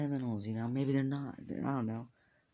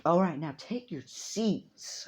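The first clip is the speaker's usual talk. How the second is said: higher, slow, firm order; slow, commanding teacher; slow stern teacher; 6 semitones higher, 4 dB louder, slower than usual. Acted. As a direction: tell them to sit, slowly. Teacher seating class.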